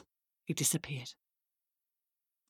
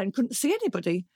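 ordinary delivery

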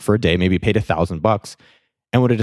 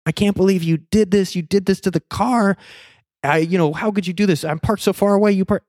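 retold yelling; 10 semitones higher than usual. Driver berating lady who hit his car, mock yelling. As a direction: imitate yelling in outrage at a raised pitch. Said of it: "I am yelling at the top of my lungs".

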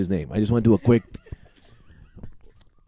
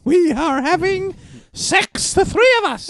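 high woman voice; slower than usual. Acted. Woman character, slow announcement.

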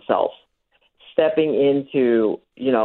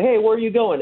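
higher-pitched mock protest; acted playful "hey", pitched up; playful calling back; 10 semitones higher than usual. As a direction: call her back, teasing. Acted playful call to pull her back.

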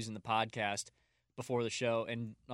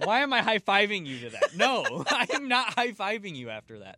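laughing high and loud through the words; 7 semitones higher, 7 dB louder than usual. Real, not acted. Host laughing as he refuses the high five.

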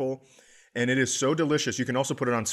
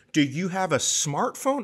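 high, patient, patronising question; talking to an old man; 6 semitones higher than usual. Retold or imitated. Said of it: "And she goes".

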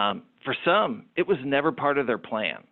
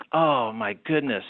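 mock dismay; 9 semitones higher, slower than usual. Realizing his embarrassing mistake.

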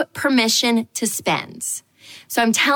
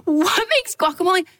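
incredulous; 9 semitones higher than usual. Retold or imitated. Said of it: "I was like".

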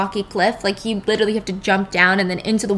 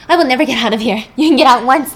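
louder, rising to a cry; desperate shout; 3 semitones higher, 8 dB louder, faster than usual. Retold or imitated. George in his cell yelling at Teddy, desperate. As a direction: make him hear there is no way out.

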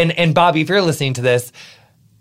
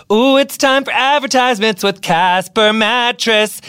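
showy ad intro; 7 semitones higher, 3 dB louder, slower than usual. Acted. Announces the ad break.

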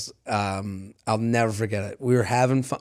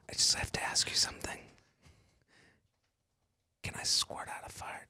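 breathy whispered come-on; mock whisper; 18 dB quieter than usual. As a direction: whisper it like a sly bedmate. Acting guy whispering in bed.

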